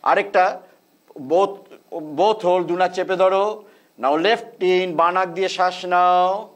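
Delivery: slow, measured breathing instructions; guided breathing cues. Real, not acted. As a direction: call each breath step calmly. Leads alternate nostril breathing.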